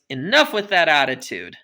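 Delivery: usual speaking voice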